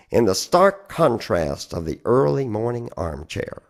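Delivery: usual speaking voice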